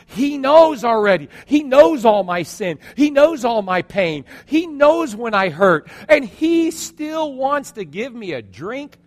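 high, loud, emphatic wonder; overwhelmed realization. Acted. Woman realizing Jesus knows all her sin, overwhelmed. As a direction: build each phrase as a ringing revelation.

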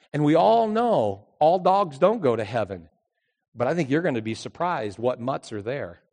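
ordinary delivery

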